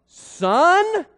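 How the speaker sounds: high, loud, sharp questioning Son; a mother demanding an answer with one word; a mother demanding why her son went missing, with an indignant loud high Son; incredulous Son. Acted. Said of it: "imagine the inflections that might have been in her voice".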